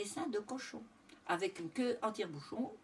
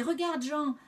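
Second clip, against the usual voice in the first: raised, louder voice of discovery; astonished cry; 5 semitones higher, 4 dB louder than usual. A narrator's reading. Farmer showing Jean the straight-tailed piglet, calling out amazed. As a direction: exclaim it to a companion. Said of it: "s'écria le premier".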